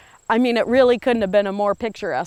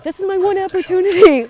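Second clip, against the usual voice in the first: urgent; 11 semitones higher, 7 dB louder than usual. Retold or imitated. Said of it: "I'm like".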